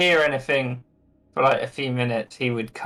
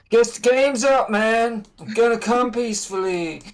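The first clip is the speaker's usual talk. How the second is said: laid-back dude voice; 9 semitones higher than usual. Acted. [name] voiced, laid-back surrender.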